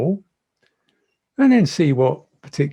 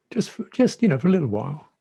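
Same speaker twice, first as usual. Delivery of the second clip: ordinary delivery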